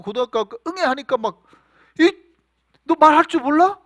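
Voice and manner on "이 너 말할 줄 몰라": loud, high exasperated rebuke; raised-voice mock outburst; parental scolding. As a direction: act out telling off a teenager who cries like a baby. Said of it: "막 혼낸단 말이죠".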